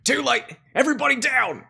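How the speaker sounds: high, loud warning yell; urgent shout. Acted. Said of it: "Seven Nine goes".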